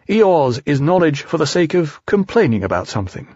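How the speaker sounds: plain reading voice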